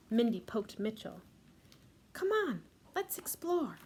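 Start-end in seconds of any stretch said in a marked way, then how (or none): 2.1-3.8 put-on high, squeaky mouse girl voice; a tiny mouse itching for adventure; eager little mouse. Mindy Mouse urging her brother.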